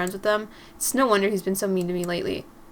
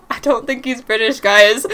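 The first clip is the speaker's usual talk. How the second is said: helpless giggly admission, getting louder; laughing; 8 dB louder than usual. Real, not acted. Reader confessing to audience about the accent, cracking up laughing. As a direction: talk through real laughter.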